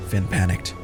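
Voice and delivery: usual speaking voice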